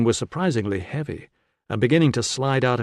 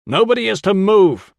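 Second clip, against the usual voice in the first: loud, high commanding yell; yelled order; 9 semitones higher, 9 dB louder than usual. A narrator's reading. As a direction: burst in yelling an order to everyone. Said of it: "a voice was yelling in British".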